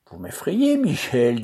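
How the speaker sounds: high mock fright through a laugh; teasing, laughing fake fear; laughing mockery. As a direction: pretend to be scared while laughing. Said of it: "en riant aux éclats".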